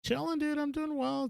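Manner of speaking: way up high, laid-back chilling; high-pitched. Real, not acted. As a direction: squeak it out playfully. Answering how are you.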